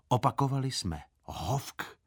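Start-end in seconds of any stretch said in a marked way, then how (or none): none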